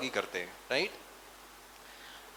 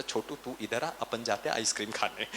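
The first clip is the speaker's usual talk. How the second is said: soft coaxing dad; 6 semitones lower, 8 dB quieter than usual. Retold or imitated. Father coaxing son away.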